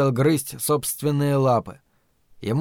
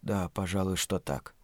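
very low, very soft murmur; subdued assent; 7 semitones lower, 11 dB quieter than usual. A narrator's reading. Servant conceding his master's claim, subdued. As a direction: concede it meekly under your breath.

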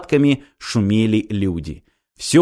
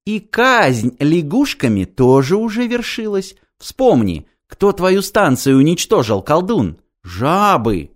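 higher, slow mocking prophet voice; taunting holy fool; 6 semitones higher, slower than usual. A narrator's reading. Holy fool taunting Ilya about his lost station. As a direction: needle him with a sly prophecy.

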